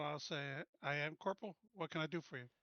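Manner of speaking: ordinary talk, nothing marked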